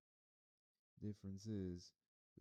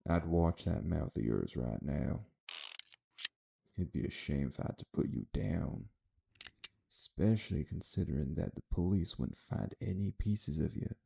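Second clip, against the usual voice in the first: loud menacing threat; 15 dB louder than usual. Acted. Gunman threatening Dion.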